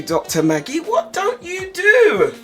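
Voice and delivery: ordinary talk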